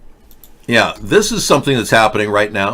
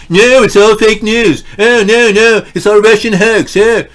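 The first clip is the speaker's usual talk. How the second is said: mocking Trump supporters; 9 semitones higher, 6 dB louder than usual. Streamer mocks Trump supporters denying everything, in a high, loud, whiny voice. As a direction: whine like a stubborn fool. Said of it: "they're all going".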